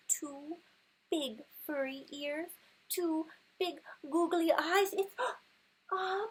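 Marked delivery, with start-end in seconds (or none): 4.9-6.2 gasp, then a much higher, louder cry; shocked discovery. Acted. Mother reading to her son, gasping as the bear appears. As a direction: burst out in mock fright.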